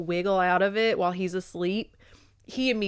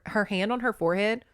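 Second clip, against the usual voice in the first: ordinary delivery, nothing marked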